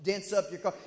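ordinary talk

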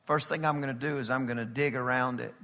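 soft, low, gentle plea; quiet pleading. Acted. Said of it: "The dresser of the vineyard said".

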